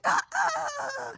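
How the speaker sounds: very high mock cackle; imitated laughing. Mom imitating daughter laughing in the next room, very high mock cackle. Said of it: "I hear her laughing".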